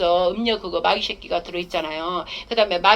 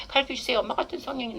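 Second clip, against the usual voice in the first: put-on soft, coaxing, babyish plea; cute pleading; 2 semitones higher, 8 dB quieter than usual. Shown as a demonstration. Preacher showing how to coax the Spirit, soft and cute. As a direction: sweet-talk the Spirit like a child. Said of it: "애교도 부리고".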